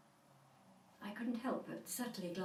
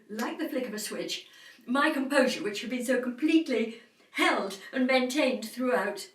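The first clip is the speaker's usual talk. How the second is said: rising anger; 5 semitones higher, 10 dB louder than usual. Acted. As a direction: let lost composure burst out loudly. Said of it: "turned to anger".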